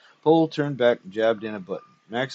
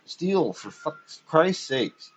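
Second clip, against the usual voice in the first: higher, slow, pleading; exasperated; 6 semitones higher, much slower than usual. A narrator's reading. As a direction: plead in disbelief. Exasperated protest.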